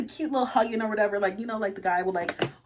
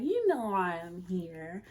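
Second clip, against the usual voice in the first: slow, coy tease; playing cute; 3 semitones lower, 4 dB quieter, slower than usual. Hugging him at his front door, playing cute. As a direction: draw it out flirtatiously. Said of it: "being all cute".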